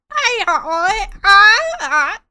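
high-pitched creature noises; kobold speaking Draconic. Acted. Pants answering the kobold in Draconic, high yelps. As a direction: yelp back in a squeaky creature tongue. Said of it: "so Pants does that".

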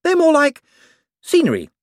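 high, eager, bright-voiced wizard; a jumpy official covering for himself. A narrator's reading. Hicks answering Moist.

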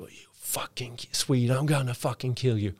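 mock scream held back in volume; retold enraged scream. Opponent screaming as referees step in, subdued mock yell. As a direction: imitate a furious yell, slowly, without full voice. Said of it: "han bara skriker".

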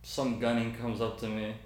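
normal talking voice

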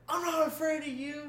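high-pitched mock bravado, a bit wobbly; mock brave. Telling an unseen ghost in the kitchen, high mock defiance.

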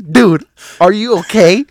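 high, loud frightened call; panicked shout. Retold or imitated. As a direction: yell it in a panic.

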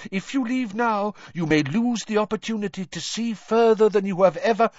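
high, insistent professor voice; urging professor. A narrator's reading. Professor begging a pupil not to leave, high and insistent.

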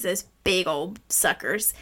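usual speaking voice

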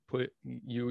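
ordinary talk

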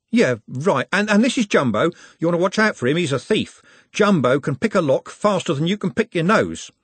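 high-pitched, lively introduction; chatty streetwise Trev. Acted. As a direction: introduce your mate with cheeky warning.

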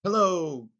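big, high, loud hello; jolly giant calling out hello; booming ghost greeting. Retold or imitated. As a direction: boom a welcome into the room. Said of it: "he says".

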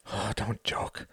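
groaned. A narrator's reading. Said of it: "Hart groaned".

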